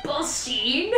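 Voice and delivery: mock German name leaping way up high; going up really high. Shown as a demonstration. Cosplayer demonstrating the doctor's name, absurdly high mock German. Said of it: "go up really high for some".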